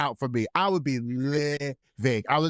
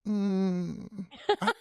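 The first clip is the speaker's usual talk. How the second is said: put-on picky kid; slower than usual. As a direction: pout like a picky child. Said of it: "the kid's like this".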